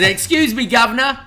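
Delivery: high, loud mock-British address; mock British accent. R-Truth mocking Waller's accent, hammed-up British. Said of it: "by mocking the British accent".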